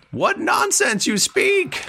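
very high, slow goblin squawk. Acted. Goblin answers Dwarven he cannot understand, high baffled goblin voice. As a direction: stretch it out way up high. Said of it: "he looks at you and goes".

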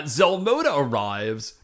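usual speaking voice

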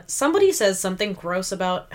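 usual speaking voice

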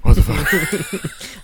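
high, loud, slow disbelief; shocked. Retold or imitated. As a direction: stretch it out in disbelief. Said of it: "and be like".

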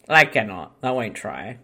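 normal talking voice

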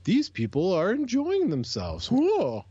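mock-scandalised nanny, pitched high; prim outrage rising to a high whoa; Mary Poppins. Podcast co-host mocking disapproval of players having fun, like a scandalised nanny. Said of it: "You sound like Mary Poppins".

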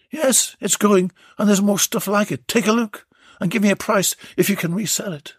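lightly voiced lady directing; a hint of a brisk client; Belinda's voice. Belinda replies to Warren.

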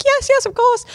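gushing high-pitched acceptance; delighted squeal of yes; excited yes. Retold or imitated. Answering a proposal, quoted.

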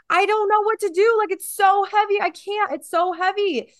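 desperate overwhelm. Pretend. Still holding the heavy weight, high desperate mock panic. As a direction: beg like you can't hold it any longer. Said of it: "And you're like".

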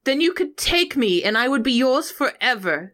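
high, mock-lovelorn stage speech; Juliet's soliloquy. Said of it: "A, or soliloquy, if you will".

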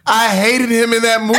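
high, loud outburst; loud exclamation. Real, not acted. Host tells guest his dislike of film character, exclaiming loudly. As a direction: belt it out in exaggerated dislike.

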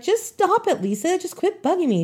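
high, mock-upset little girl pleading; upset protest. Hurt girl telling the bully to leave her alone, high pleading protest. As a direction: beg her to stop, voice up high. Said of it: "I was like".

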